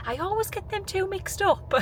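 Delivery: high-pitched mock confusion; imitated shop assistant. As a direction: mimic a chirpy, muddled cashier.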